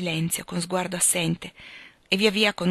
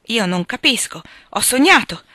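loud, confused protest rising higher; agitated bewilderment; 4 semitones higher, 7 dB louder than usual. Acted. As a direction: struggle to grasp it, distraught. Bewildered patient doubts what he hears.

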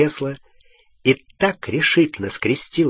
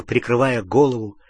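plain reading voice

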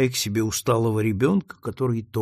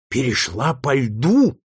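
astonished exclamation; 4 dB louder, slower than usual. A narrator's reading. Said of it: "вскричали в один голос все присутствовавшие".

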